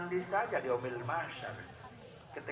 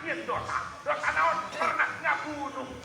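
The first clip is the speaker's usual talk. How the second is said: loud, high-pitched sermon cry to the congregation; fiery preaching; 10 semitones higher, 12 dB louder than usual. Acted. Preacher acting out the prophets' replies, loud preaching. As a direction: preach it at full force.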